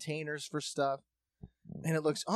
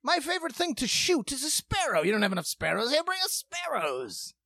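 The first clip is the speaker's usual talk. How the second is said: much higher, pompous toff voice; mock English settler; 11 semitones higher than usual. Rich English settlers in Ohio wanting sparrows, high and pompous. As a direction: play a silly rich colonist demanding birds. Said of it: "rich English settlers in Ohio were like".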